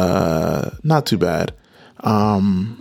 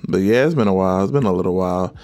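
normal talking voice

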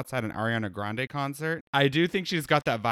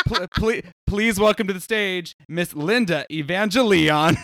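high, loud drag show host; slow, grand drag queen introduction; campy drag queen; 5 semitones higher, 7 dB louder, slower than usual. Retold or imitated. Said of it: "I was like".

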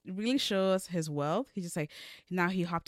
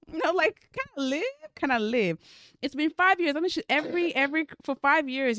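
high, loud mock exasperation; exasperated pleading; 8 semitones higher, 6 dB louder than usual. Son nagged by mom for years, acting exasperated. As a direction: plead to be left alone.

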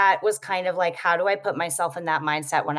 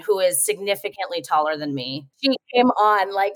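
ordinary talk, nothing marked

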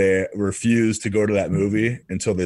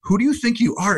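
mock indignant; 11 semitones higher than usual. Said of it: "it's like".

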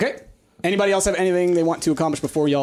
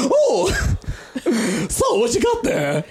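silly clown; 9 semitones higher, slower than usual. Acted. Shopkeeper voiced.

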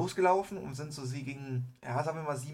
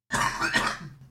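dry coughing bout; coughing fit; 6 dB louder than usual. Real, not acted. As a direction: cough hard a few times. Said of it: "Ich muss gerade meinen Hustenanfall".

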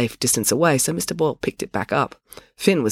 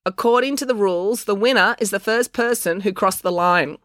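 higher-pitched, officious rule quoting; pedantic; 8 semitones higher than usual. A narrator's reading. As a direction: recite the rules like a stickler. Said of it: "with his characteristic pedantry".

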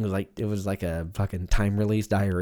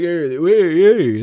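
loud, high, slurred boxer yell; Rocky; 7 semitones higher, 11 dB louder, much slower than usual. Acted. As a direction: holler like a caricature movie boxer. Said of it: "how it sound like Rocky".

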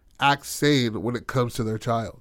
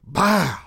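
gunshot; 7 semitones higher, 6 dB louder than usual. Retold or imitated. Gun fired in air.

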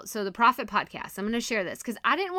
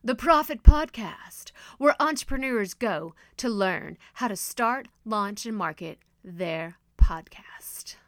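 radio announcer voice; 5 dB louder than usual. Shown as a demonstration. Podcaster hamming up a radio announcer intro. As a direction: boom it out like a radio host, trailing off. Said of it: "I got my radio voice on".

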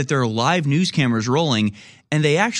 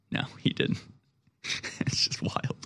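whispered aside; 11 dB quieter than usual. Real, not acted. Aside after mock quote.

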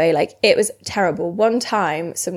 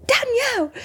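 mum scolding. Retold or imitated. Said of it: "My mum was like".

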